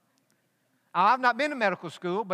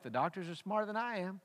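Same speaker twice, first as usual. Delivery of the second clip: said to himself; 7 dB quieter than usual. Retold or imitated. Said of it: "he said to himself".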